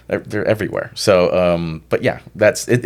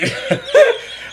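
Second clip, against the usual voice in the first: high burst of laughter. Real, not acted.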